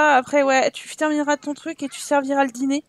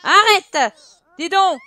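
loud sharp stop; sharp shout; 4 semitones higher, 5 dB louder, slower than usual. Acted. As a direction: yell it to stop the kids. Scolding shouting kids. (crier à un enfant pour qu'il arrête)

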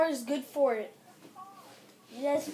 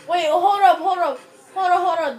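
loud, higher, repeated calls to stop; urgent interruption; 4 semitones higher, 10 dB louder than usual. Real, not acted. Gamer halting friends over something just seen, urgent interruption. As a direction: cut in loudly and urgently.

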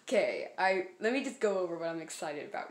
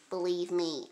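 ordinary delivery

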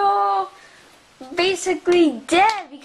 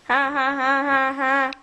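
lower, spelled-out mock evil ha ha; villain laugh; 6 semitones lower than usual. Villain gloating over the captured toy, mock evil laugh. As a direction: laugh like a playground bad guy.

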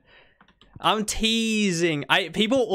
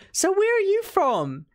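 high-pitched imitation of a broad Kiwi accent; high mock southern Kiwi accent, questioning; strong Kiwi accent; 7 semitones higher than usual. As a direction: put on a thick, nosy Kiwi accent. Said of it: "she has a really strong Kiwi accent".

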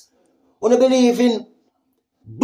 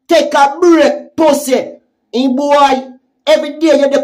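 angry shout; 5 semitones higher, 6 dB louder than usual. Real, not acted. Ranting at a rival vlogger who is always online, angry shout. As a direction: yell it at him like you've had enough.

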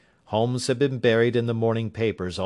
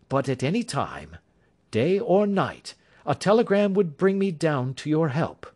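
higher, assured detective voice; Holmes; 7 semitones higher than usual. A narrator's reading. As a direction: Sherlock Holmes reassuring his worried client, higher assured voice. Holmes voice again.

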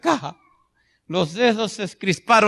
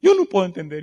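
grieving widow; 11 semitones higher, 4 dB louder than usual. Retold or imitated. Preacher voicing a widow refusing her children, half-wailed. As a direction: plead first, then let it sink. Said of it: "los hijos ... mamá entiende".

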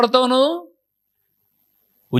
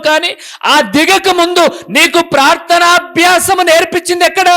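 preaching at full force; 6 semitones higher, 3 dB louder than usual. Acted. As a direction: build the sermon to a ringing climax. Preacher rebuking the proud.

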